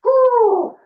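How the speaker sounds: high, loud cheer; celebrating cheer to kick off; excited whoop. Real, not acted. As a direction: let out a big happy whoop. Cheer at start of exercise.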